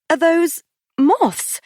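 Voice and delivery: high, slow, stretched disgust; high horrified question; appalled. A narrator's reading. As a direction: recoil at the pan.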